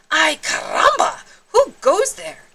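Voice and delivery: high, loud Spanish-flavoured call; startled challenge. Acted. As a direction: challenge the strangers in the dust. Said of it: "asked Skippy John Jones".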